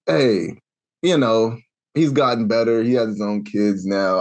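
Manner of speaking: ordinary talk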